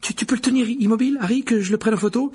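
high, fast, loud pleading; excited, stammering high chatter; eager little boy. Acted. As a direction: gabble it eagerly in a child's voice. Colin begs to photograph Ron.

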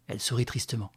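plain reading voice

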